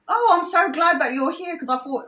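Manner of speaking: high, sweet grandma voice; quoting grandma. Retold or imitated. Grandma greeting nephew's fiancée, high and relieved. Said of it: "grandma said to me".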